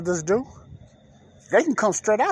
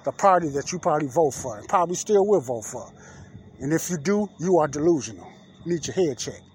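ordinary talk

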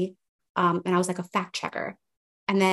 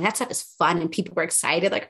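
ordinary delivery

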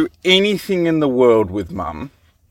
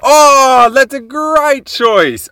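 much higher and louder, slow delighted praise; gushing praise; 12 semitones higher, 5 dB louder, slower than usual. Real, not acted. Radio host praising a little boy's song choice, high and delighted.

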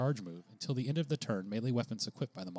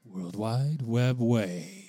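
announcer intro voice; much slower than usual. Acted. Intro jingle answer.

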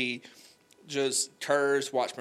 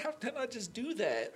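high, softer self-reproach; dismayed at himself; 9 semitones higher, 6 dB quieter than usual. Retold or imitated. Crying in bed after relapse, high despairing question. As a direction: ask it in quiet despair. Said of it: "was like".

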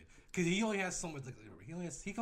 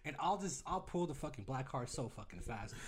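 normal talking voice

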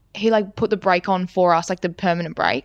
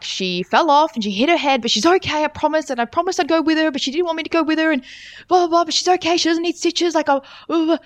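high-pitched, run-on retelling; imitated rattled-off report; 8 semitones higher than usual. Boy reporting a friend's fall, imitated high reassuring babble. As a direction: reel it off high, imitating someone hurriedly explaining. Boy's rushed report of accident retold.

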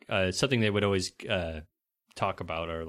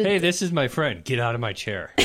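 louder, higher start, imitating the dad; hammed-up movie quote ordering someone off; mock indignant order; 5 semitones higher, 6 dB louder than usual. Friend acts out the dad's chair scene, mock-indignant.

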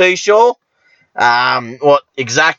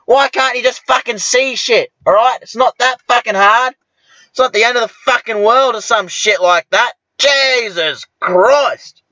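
angry rant; 6 semitones higher than usual. Retold or imitated. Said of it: "And I'm like".

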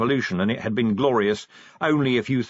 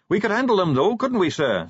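Carrot's eager voice; 10 semitones higher, 3 dB louder than usual. Acted. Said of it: "said Carrot".

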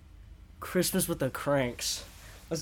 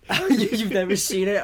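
laughing in disbelief; high and loud through laughter; 6 semitones higher, 7 dB louder than usual. Real, not acted. Laughs at a friend's answer.

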